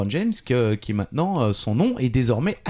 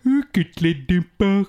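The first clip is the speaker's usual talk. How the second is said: switching impression voices; 5 semitones higher than usual. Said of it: "qui fait la meilleure imitation?".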